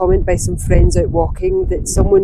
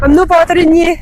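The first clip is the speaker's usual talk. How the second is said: raised voice against the wind; shouting over wind; 9 semitones higher, 8 dB louder than usual. Real, not acted. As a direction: call out loud over the gusts. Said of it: "I don't even know if you can hear me over the wind".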